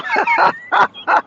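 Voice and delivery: high burst of laughter. Real, not acted. Laughing at his own joke, high burst of laughter. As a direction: laugh out at your own quip.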